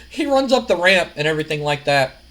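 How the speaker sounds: plain reading voice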